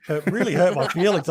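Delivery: normal talking voice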